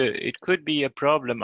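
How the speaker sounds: ordinary talk, nothing marked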